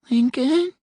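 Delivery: high, distant woman's voice on the phone; a thin call from far away; small faraway voice. A narrator's reading. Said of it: "the woman's voice repeated".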